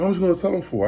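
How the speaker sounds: high, loud mock lady voice; nosy lady. Lady asking his selling price, high mock voice. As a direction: put on a high voice and push for a price.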